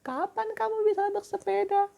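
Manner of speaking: put-on very high girlish voice; girl's voice. Shown as a demonstration. Teacher voicing Siti for the class. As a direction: squeak it out like little Siti. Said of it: "suara cewek".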